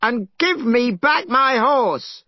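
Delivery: high, loud and slow demand; shouting. Acted. Monkey demands his horse from the dragon, shouting. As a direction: yell the demand. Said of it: "shouted Monkey".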